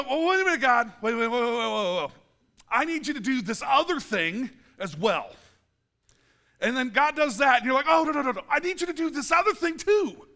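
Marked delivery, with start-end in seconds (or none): 0.0-5.3 high, loud, rapid-fire "wait"; hammed-up urgent bargaining; frantic stalling. Acting people stalling God, demanding more. 7.9-10.3 high, loud protest; hammed-up pleading objection; frantic insisting. Acting people demanding more from God.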